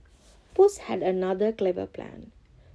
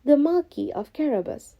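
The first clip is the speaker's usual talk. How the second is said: higher, eager workers; workers reply; 7 semitones higher, 4 dB louder than usual. Acted. Workers answer the king eagerly, higher voice. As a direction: answer brightly. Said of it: "the workers replied".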